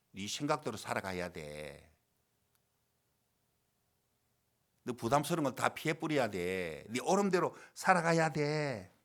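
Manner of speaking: slow, drawn-out tempter's voice; tempting Satan. Acted. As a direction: plant the thought slowly, stretching the endings. Acts out Satan planting thoughts. (사탄이 꾀듯 말끝을 길게 늘이며)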